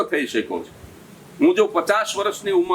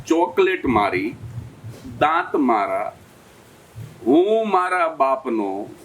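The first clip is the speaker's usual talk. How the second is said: put-on slow, much lower, defiant child's punchline; smug kid; 7 semitones lower, slower than usual. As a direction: lay it down slowly like a cocky brat. Kid's punchline repeated.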